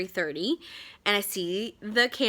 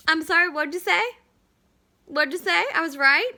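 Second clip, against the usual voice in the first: higher, louder mock disbelief; gloating; 7 semitones higher, 4 dB louder than usual. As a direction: rub it in, teasing. Gloating at husband.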